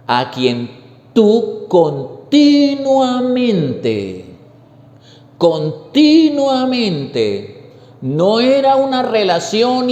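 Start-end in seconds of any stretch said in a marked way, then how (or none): 0.1-7.7 continuamente stretched very slow and higher, each syllable weighed; stress on continuamente. Shown as a demonstration. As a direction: underline the word continuamente by drawing it out slowly. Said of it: "miren esta frase para que la subraye".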